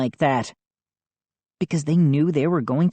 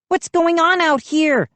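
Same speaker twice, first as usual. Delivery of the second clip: calling out; 12 semitones higher than usual. A narrator's reading. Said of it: "a familiar voice called".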